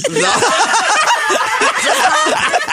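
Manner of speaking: real laugh breaking out; burst of laughter. Real, not acted. Laughing at the story. (un vrai éclat de rire aigu)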